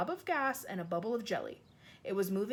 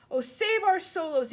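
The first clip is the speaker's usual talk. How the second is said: higher, louder, slow wail; furious anguish; 9 semitones higher, 8 dB louder than usual. Acted. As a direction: rage and despair at once. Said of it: "filled with fury and anguish".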